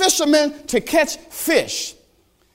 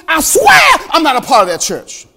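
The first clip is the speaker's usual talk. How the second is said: loud, higher, heated oath; swearing denial; 6 semitones higher, 6 dB louder than usual. Retold or imitated. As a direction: Peter cursing as he denies. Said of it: "To cursing".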